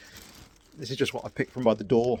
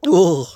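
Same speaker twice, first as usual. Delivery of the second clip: sour gasp; 6 semitones higher, 13 dB louder than usual. Real, not acted. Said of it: "that's sour".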